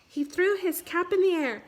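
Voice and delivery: usual speaking voice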